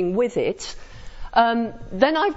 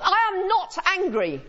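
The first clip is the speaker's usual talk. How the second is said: higher, tight-lipped fake calm; sarcastic denial; 9 semitones higher than usual. Shown as a demonstration. Lecturer shows tone flips meaning, pitched-up sarcastic denial. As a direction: deny it while clearly fuming. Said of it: "the meaning you will have got from that is that I'm angry".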